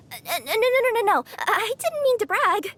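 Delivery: stammering, rising, hurried no-no-no; flustered denial. Acted. Catgirl backpedalling to a traveler after bragging, flustered.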